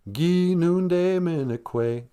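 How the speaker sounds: "Gi nunde minekwe" very slow, the Gi stressed; slow teaching demo. Shown as a demonstration. To the kids, teaching you are thirsty, very slow. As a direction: stretch "Gi nunde minekwe" out, leaning on Gi. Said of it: "with a G".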